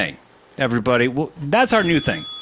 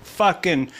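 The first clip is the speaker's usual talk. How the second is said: ordinary talk, nothing marked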